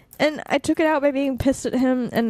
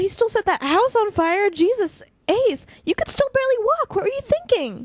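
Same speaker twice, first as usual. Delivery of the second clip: high, incredulous and upset; alarmed disbelief; 7 semitones higher than usual. Acted. Confronting Ace about breaking him out, alarmed. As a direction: voice jumps up in shocked worry. Friend alarmed at reckless rescue.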